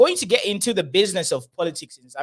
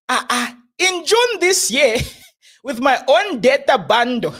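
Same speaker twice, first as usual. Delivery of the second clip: mock Nigerian accent; 7 semitones higher than usual. Host mocking the prophet's post in a Nigerian accent. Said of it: "let me do a C R one accent".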